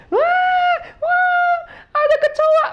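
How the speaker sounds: very high, loud shriek; panicked scream. Retold or imitated. Mother finds a cockroach in the toilet, screaming. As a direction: shriek in alarm at the pest. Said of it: "mereka teriak".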